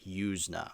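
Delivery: "Yuzna" as YOOZ-nuh, long oo, soft; correct pronunciation. Shown as a demonstration. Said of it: "That's how you pronounce it".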